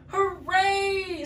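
high, drawn-out hooray; group cheer. Acted. All the friends cheering together, high and drawn out. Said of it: "said all of our friends".

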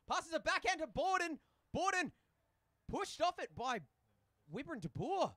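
high, loud excited hockey commentary; live play-by-play. Real, not acted. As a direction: call the play at rising pitch, building excitement.

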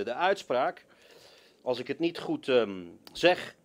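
usual speaking voice

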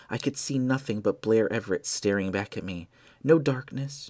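ordinary delivery, nothing marked

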